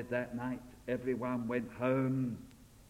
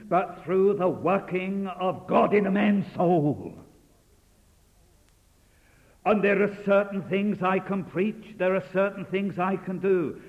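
raised, emphatic pulpit preaching; fervent sermon delivery; 6 semitones higher, 7 dB louder than usual. Acted. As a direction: drive the point home to the congregation. Opening exhortation to congregation.

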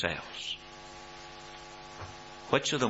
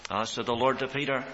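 usual speaking voice

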